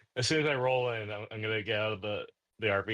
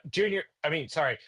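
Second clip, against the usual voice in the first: higher-pitched parental call; calling a kid over; 7 semitones higher than usual. Acted. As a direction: summon the kid out, stumbling over the name.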